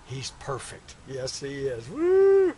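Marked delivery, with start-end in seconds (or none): 1.9-2.5 high, loud whoop; joyful whoop. Acted. New father cheering his newborn, high joyful whoop. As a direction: let out a whoop of joy.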